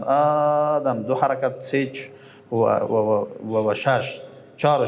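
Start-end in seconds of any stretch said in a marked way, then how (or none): 0.0-1.0 آدم with a very long drawn first vowel; long madd demo. Shown as a demonstration. Teacher shows the long madd in آدم to students. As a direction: stretch the آ of آدم slowly. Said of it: "سه وجه داره".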